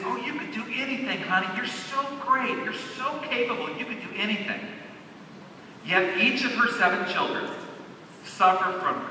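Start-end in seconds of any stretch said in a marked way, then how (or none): 0.0-4.6 high, soft cooing mother; soft high motherly coo; reassuring mother. Retold or imitated. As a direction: coo encouragement like a doting mom. Mother voiced in story.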